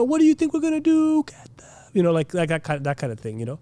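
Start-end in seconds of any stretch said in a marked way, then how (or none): 0.0-1.2 high-pitched rabble-rouser; crowd-baiting preacher. Acted. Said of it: "bringing up issues that will make them mad". 1.2-1.7 whispered crowd roar; mock crowd answer. Acting crowd's reply.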